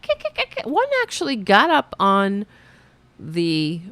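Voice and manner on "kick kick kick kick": squeaky high kick kick chatter; squirrel chatter. Retold or imitated. Imitating squirrels chattering at her, high squeaky chirps. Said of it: "they're like".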